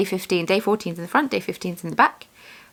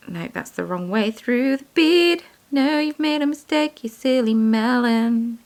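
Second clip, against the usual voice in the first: mock scolding; 5 semitones higher, slower than usual. Scolding herself over bead mistake.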